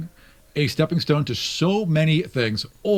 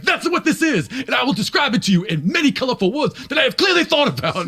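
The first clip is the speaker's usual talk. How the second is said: high, loud, fast intense rant; yelling tone; 8 semitones higher, 4 dB louder, faster than usual. Retold or imitated. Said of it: "that same yelling tone".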